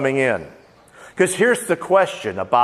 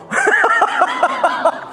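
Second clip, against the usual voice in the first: sudden high burst of laughter; big laugh. Real, not acted. Preacher cracks up teasing Norman in the congregation, big laugh.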